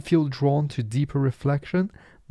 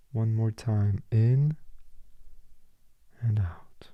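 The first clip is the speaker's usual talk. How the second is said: guided breathing. Acted. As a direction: lead each breath unhurriedly, leaving room to breathe. Leads a breathing exercise.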